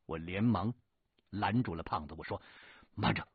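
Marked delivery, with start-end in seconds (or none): 2.9-3.3 loud, higher "wait!"; urgent call to stop. Acted. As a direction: stop him in a hurry. 连忙拦住胖子. (急忙喊住)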